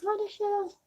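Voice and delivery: hushed, barely voiced aside; retold whisper. Mother secretly complaining as the boy offers chips, whispering. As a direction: mutter it very softly under your breath. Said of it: "I was whispering it".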